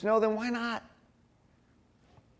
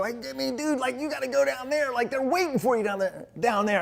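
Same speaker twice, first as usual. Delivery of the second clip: higher, casual surfer-dude plea; higher laid-back dude urging him on; Olympic team guy; 6 semitones higher than usual. Retold or imitated. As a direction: coax Jimmy along like a laid-back buddy. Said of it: "the Olympic team will come up and go".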